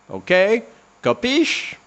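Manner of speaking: high playful Capiche; mock mobster. Podcaster teasing listeners to subscribe, mock mobster. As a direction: tease the listener like a wiseguy. Playful tough-guy check-in.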